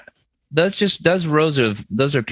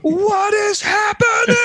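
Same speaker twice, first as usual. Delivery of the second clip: shocked. Real, not acted. Shocked reaction to news.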